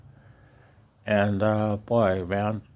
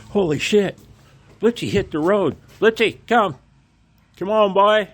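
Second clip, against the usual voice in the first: yelling loud and high after a dog running off; full-throated shout to call the dog back; 14 semitones higher, 10 dB louder than usual. Real, not acted. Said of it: "Sorry for shouting".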